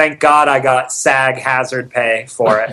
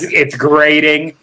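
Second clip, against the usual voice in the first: usual speaking voice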